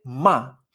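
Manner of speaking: "ma" falling sharply; fourth tone. Shown as a demonstration. Teacher naming the verb to insult, dry falling "ma". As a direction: cut "ma" off downward. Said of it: "M A con cuarto tono".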